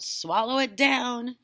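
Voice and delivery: put-on boy urging grandma to swallow; eager urging. A narrator's reading. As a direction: push the medicine on her. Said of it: "George cried out".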